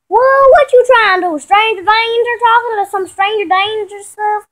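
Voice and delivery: loud, high startled shout. Acted. Vanessa shouting at her sister on the phone, high and loud. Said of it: "shouting at her".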